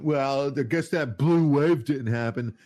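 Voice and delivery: mocking smug liberal voice; smug mocking quote. Mocking the people who gloat about elections, smug. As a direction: sneer it like a gloating opponent. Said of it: "people going".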